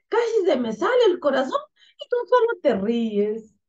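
high, indignant puppet voice; startled puppet boy. Acted. Acting frightened boy puppet. (niño títere asustado y quejoso)

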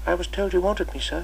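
put-on shy new boy asking at the door; timid small boy. Boy answers Chips.

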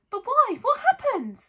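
high, loud urgent questions; Harry, urgent. A narrator's reading. Harry demanding the truth from Hagrid, urgent. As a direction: press urgently for an answer. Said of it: "asked Harry urgently".

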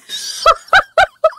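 loud, high-pitched helpless laugh; real laughing fit. Real, not acted. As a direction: crack up mid-reading. Reader breaks character laughing.

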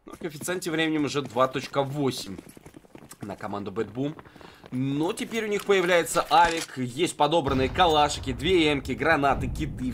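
usual speaking voice

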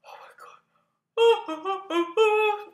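slow words giving way to a big high yawn; real yawn overtaking the speech, rising and loud. Real, not acted. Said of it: "I'm gonna yawn again".

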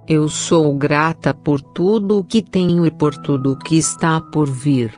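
ordinary talk, nothing marked

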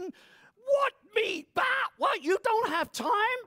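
high, loud disbelieving shout; incredulous outburst. Acted. Preacher scolding the congregation, shouting in disbelief. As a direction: explode in mock disbelief.

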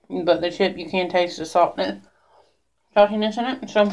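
talking while chewing; speech muffled by food; mouth full. Real, not acted. Seen 0: bites chocolate chip repeatedly, chews with hand over mouth.

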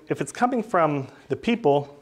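ordinary delivery

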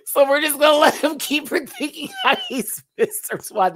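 high, loud mocking cartoon voice; mocking the show. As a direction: ham up the cartoon characters' tone. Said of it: "they were like".

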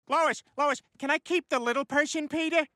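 put-on high begging child voice; pleading whine. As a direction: beg like a kid for a puppy. Husband begs to keep child like pet.

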